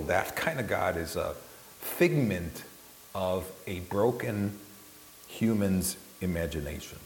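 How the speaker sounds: usual speaking voice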